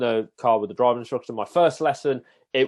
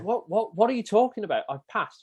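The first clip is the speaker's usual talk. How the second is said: higher, bewildered questioning; baffled retelling; 9 semitones higher than usual. Retold or imitated. Learner to his apologising instructor, high bewildered protest. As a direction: act out his confused protest, pitched up.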